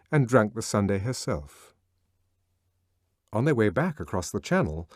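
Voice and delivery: plain reading voice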